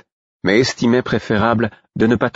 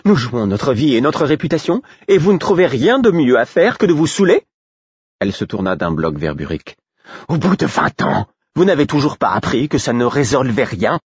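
angry outburst; 8 semitones higher than usual. Acted. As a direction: tear into the drinkers. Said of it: "pour s'emporter".

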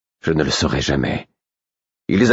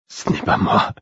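barely articulated. A narrator's reading. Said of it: "C'est à peine si j'avais pu articuler ces mots".

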